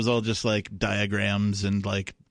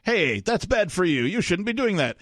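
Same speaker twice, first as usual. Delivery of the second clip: much higher-pitched parody of a father's reproach; mock scolding dad; 9 semitones higher than usual.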